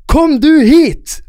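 high, loud, stretched-out yell; retold shout back. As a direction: yell it back slowly, word by word. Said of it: "roper tilbake".

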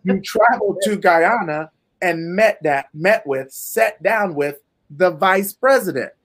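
slow, deliberate restatement, phrase by phrase. Shown as a demonstration. Host repeats her feat for the people listening, slowed down. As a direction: say it slowly so every part lands. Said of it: "slow it down for the people listening".

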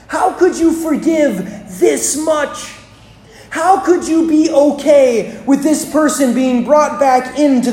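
hurt outrage trailing off; outraged plea. Acted. Preacher voicing the older brother's outrage at the father, indignant.

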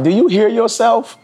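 high, disbelieving challenge; retold shocked question, pitched high; incredulous. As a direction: ask it like they've lost their mind. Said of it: "I was like".

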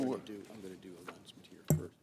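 quick hushed aside; whispering. Real, not acted. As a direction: whisper it fast under the breath. Whispered aside before the oath.

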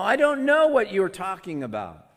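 loud, high, indignant denial; emphatic denial. A narrator's reading. Peter denying it before them all, loud and indignant. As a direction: deny it in front of everyone. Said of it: "But he denied it before them all".

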